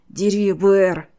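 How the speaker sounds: loud, drawn-out urging cry; slow, forceful command; sharp shout. A narrator's reading. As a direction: shout the order, stretching the words. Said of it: "прикрикнул подмастерье".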